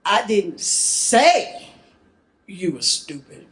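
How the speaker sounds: "say" stretched long and lifted, then drop away; stress on "say". Shown as a demonstration. Presenter shows class that emphasis changes meaning, stress on "say". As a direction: lean hard and slow on "say". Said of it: "depending on which word is emphasized".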